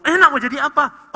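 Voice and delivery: mildly acted parent question; parent asking child. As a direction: ask like a curious parent, lightly voiced. Parent asking child his dream.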